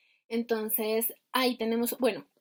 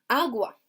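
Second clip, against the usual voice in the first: agua answered with the opening A stressed; first A drawn out and punched in agua; stress on first syllable; 3 dB louder than usual. Retold or imitated. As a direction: say agua as AH-gwa, as a student finding it. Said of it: "en la primera".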